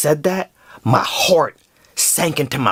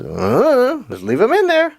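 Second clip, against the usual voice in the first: Marcos, nervous and higher; 8 semitones higher than usual. Retold or imitated. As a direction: play the unsure coworker. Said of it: "Marcos just goes".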